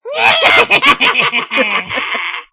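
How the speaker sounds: loud cackling spooky Halloween laugh; witch laugh. Shown as a demonstration. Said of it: "Just do, like, a witch laugh".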